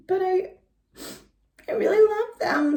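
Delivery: put-on high, teary little girl; tearful plea. Pink fluffy girl crying to Missus about the mittens. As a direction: plead through tears. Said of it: "started to cry".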